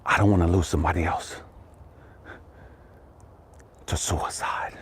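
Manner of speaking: breathy whispered end. Real, not acted. Preacher whispering about losing people to suicide. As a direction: let the word die into a whisper.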